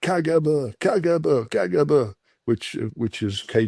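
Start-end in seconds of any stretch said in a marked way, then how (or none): 0.0-2.0 high repeated mock shout of K G B; retold shout. Drunk giant with a pistol yelling at the narrator in the lift, mock shout. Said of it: "started shouting".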